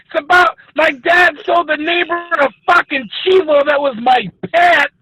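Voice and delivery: mock furious rant. Storyteller snaps at his cohost about his eaten pet goat, hammed-up teary anger. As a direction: rant like the wound is still fresh, half-crying.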